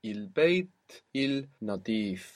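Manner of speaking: "البيت النظيف" word by word, with a gap; slow, unblended "il" before "النظيف", L kept; said slowly. Shown as a demonstration. Teacher spelling out the clean house, slowly, L sounded. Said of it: "if we say it slowly".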